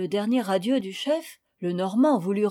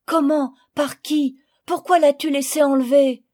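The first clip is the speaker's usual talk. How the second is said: alarmed questions; 4 semitones higher, 6 dB louder than usual. Acted. Jacques interrupts the story, alarmed.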